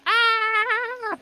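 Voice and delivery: high-pitched mock battle scream; long "aah" as you run at the enemy; charging yell. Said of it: "runs forward and goes".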